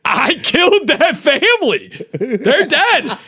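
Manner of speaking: high-pitched panicked cry; freaking out. Retold or imitated. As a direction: wail it as a horrified confession. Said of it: "I started freaking the fuck out".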